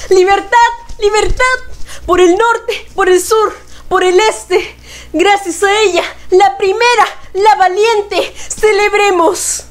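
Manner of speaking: high, rising patriotic build; rousing. Acted. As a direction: lift it to a triumphant climax. Climax of the poem.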